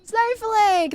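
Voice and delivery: high, loud greeting to a donor; bright burst of thanks, loud and high; excited shout-out. Real, not acted. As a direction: call the name out high and delighted.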